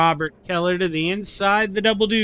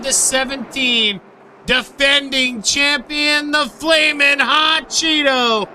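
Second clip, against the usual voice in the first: hype announcer; 7 semitones higher than usual. Acted. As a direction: build it up like a ring announcer. Announcing the pole row.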